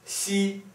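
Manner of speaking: xi hoch und gleichbleibend; first tone. Shown as a demonstration. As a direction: xi hoch gehalten. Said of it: "First tone".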